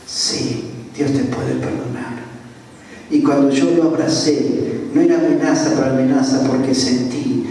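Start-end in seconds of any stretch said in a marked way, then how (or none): 0.1-2.2 soft, low comforting voice; tender reassurance. Retold or imitated. Pastor assures the weeping robber of forgiveness, tender reassurance. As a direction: lower and hush the voice to console.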